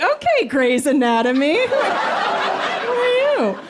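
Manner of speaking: squeaky high teasing; playful teasing. Retold or imitated. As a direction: tease him in a rising squeal. Teasing the boyfriend.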